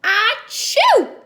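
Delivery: big high sneeze; loud sneeze. Acted. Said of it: "sneezed Kyle".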